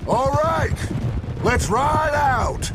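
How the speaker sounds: loud, slow rallying call; rallying shout. Acted. Dutch rallying his men to ride out, loud and slow.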